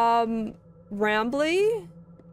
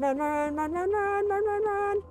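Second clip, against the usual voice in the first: being chased; 5 semitones higher than usual. Real, not acted. Being chased, running away.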